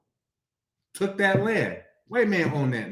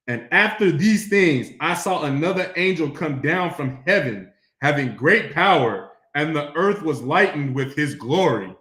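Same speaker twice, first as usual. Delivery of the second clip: scripture reading; 5 dB louder than usual. Acted. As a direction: declaim the verse with weight. Reads scripture aloud.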